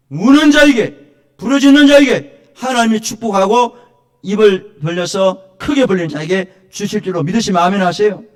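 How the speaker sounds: loud, high preacher's proclamation; impassioned pulpit cry, slowing on the opening words; full-force preaching. Real, not acted. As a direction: proclaim the blessing at the top of your voice.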